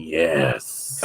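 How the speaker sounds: mock creepy relish. Friend peering through a window, mock creepy relish. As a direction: savour it like a peeping creep. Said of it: "He's there with a Twinkie and a Slim Jim going".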